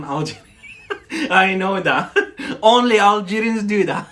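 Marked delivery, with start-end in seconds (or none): 1.1-4.1 laughing through the words, louder. Real, not acted. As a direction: tease while cracking up. Teasing, laughing at own joke.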